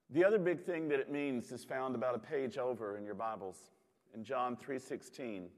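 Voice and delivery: ordinary talk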